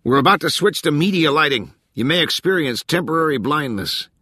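high, projected crew warning; megaphone announcement. A narrator's reading. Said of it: "yelled into a megaphone".

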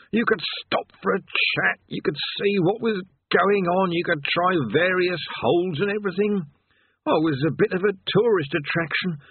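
a tired damned man sighing over his lot; weary chained man. Acted.

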